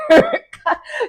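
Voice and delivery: loud, high cackle; a high burst of laughter. Real, not acted. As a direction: crack up at the cast member's coldness. Laughing at Kyle not caring.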